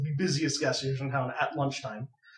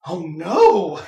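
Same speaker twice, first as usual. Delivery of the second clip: loud, alarmed retelling of the attendant; animated panic; 10 dB louder than usual. Retold or imitated. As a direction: burst out as if the problem is a disaster. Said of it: "in a really animated manner goes".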